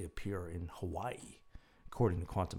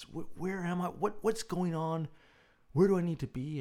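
put-on confused old woman; 9 semitones higher than usual. Confused resident voiced in story.

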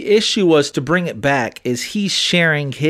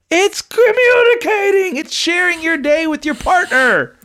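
much higher, louder shouting; heated shout; 13 semitones higher, 3 dB louder than usual. Real, not acted. Arguing with her friend about texting, shouting higher. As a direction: yell the point across the room.

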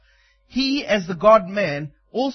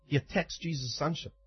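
ordinary talk

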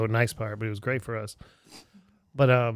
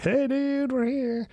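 high cheery hello to a friend; excited greeting; 13 semitones higher, slower than usual. Retold or imitated. Greeting Constantine coming down the street, excited and high. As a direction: call out happy to see him.

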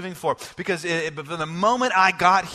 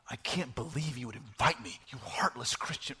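mock offended dad; 6 semitones lower, 10 dB quieter than usual. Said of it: "No, I didn't say that".

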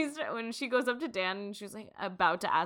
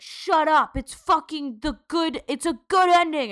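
higher, louder defensive yell; 8 semitones higher, 10 dB louder than usual. Retold or imitated. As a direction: bark it at the fans. Showrunners yelling at fans.

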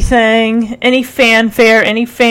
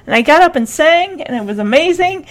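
excited; 6 semitones higher, faster than usual. Retold or imitated. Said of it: "Rick, of course, is like".

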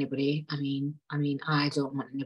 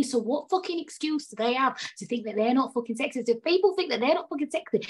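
much higher, exasperated; indignant rant; 7 semitones higher than usual. Real, not acted. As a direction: demand an answer, exasperated. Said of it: "I went on a little rant".

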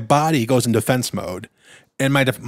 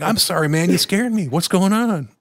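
apologetic fix-it voice; 6 semitones higher than usual. Retold or imitated. Apologizing to scared boy.

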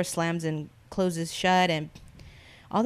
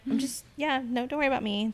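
high reassuring; 6 semitones higher than usual. Retold or imitated. Reassuring the suspicious homeowner.

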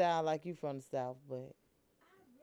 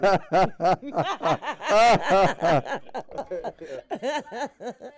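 long loud laughing fit; 8 dB louder than usual. Real, not acted. Host cracking up after teasing the guest, a long laugh. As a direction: crack up at your own joke.

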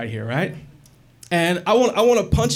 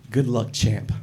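lower, patronising and cool; smug low pat-on-back; 6 semitones lower, slower than usual. Retold or imitated. David pats his rival's shoulder, patronising and low. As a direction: say it like a winner to a loser. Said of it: "and he says".

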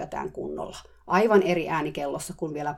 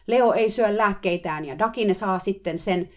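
loud, higher mock shriek; 4 semitones higher, 6 dB louder than usual. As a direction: keep screaming the complaint. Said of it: "kiljui nyt kurkku suorana".

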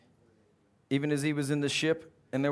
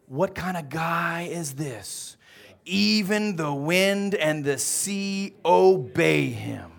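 awed disciples; 4 dB louder, much slower than usual. Acted. Awed disciples after the calm.

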